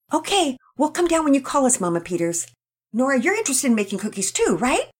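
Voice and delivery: put-on cheerful girl promising; Penelope answering Mama then asking Nora; Penelope, eager girl. A narrator's reading. Penelope voiced.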